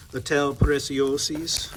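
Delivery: slow, exaggerated Italian accent on Preziosis. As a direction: say Ital Preziosis like a hammy Italian. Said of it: "I apologize for my hideous Italian accent".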